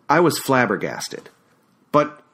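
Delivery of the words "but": stammering. A narrator's reading. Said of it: "I stammered".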